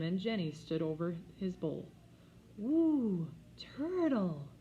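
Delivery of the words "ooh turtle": put-on high, delighted child voice; awed little girl. A narrator's reading. Jenny seeing the turtle, high delighted coo.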